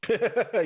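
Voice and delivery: high burst of laughter; short, high real laugh. Real, not acted. Laughs at the lucky roll.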